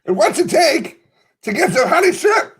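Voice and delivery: very high-pitched silly voice; comic put-on character. Improvised customer asking about honey shrimp, high silly voice. Plays frantic recruit in improvised scene.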